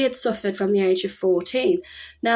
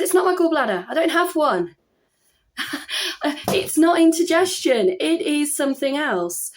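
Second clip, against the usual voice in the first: replaying her own exasperated protest at a higher pitch; retold insistence; 7 semitones higher than usual. Insisting to the NHS helpline.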